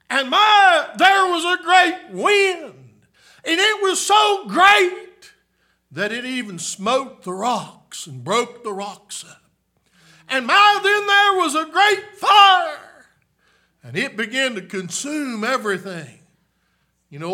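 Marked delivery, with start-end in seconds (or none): 0.1-5.3 high, loud preaching build; preaching shout. Acted. 10.3-13.1 high, loud preaching build; preaching shout. Acted.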